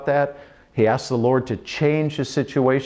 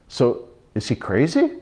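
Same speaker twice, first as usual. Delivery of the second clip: usual speaking voice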